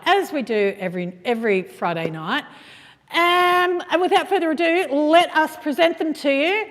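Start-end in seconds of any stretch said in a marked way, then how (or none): 3.1-6.7 grand showbiz host voice, high and loud; breathy vintage purr. Acted. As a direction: introduce the star like an old-time host. Host introduces guest grandly.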